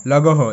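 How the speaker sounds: "লাঘ হ" spelled out as la-go-ho; mistaken pronunciation. Shown as a demonstration. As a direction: sound g and h separately in "লাঘ হ". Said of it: "কিন্তু but এরকমটা হবে না".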